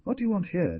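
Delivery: high-pitched call, little extra volume; high, restrained storybook shout; narrated shout. A narrator's reading. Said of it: "decided to be the first to speak and shouted".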